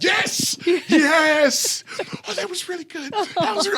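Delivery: high, elated shouts; excited cheer. Retold or imitated. Director praising the violent take, mock excited yells. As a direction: cheer it like the take was perfect.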